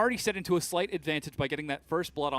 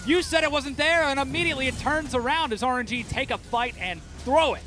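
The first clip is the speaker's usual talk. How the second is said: excited; 10 semitones higher, 6 dB louder than usual. Real, not acted. Caster hype during teamfight.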